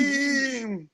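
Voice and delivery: high, drawn-out catchphrase cry; catchphrase call. Pretend. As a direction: stretch it out high like a jingle. Echoes the show's catchphrase.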